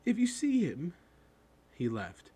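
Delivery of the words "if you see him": high, forlorn request; dejected boy's high voice; miserable. A narrator's reading. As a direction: trail a faint hope through misery. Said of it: "said the boy miserably".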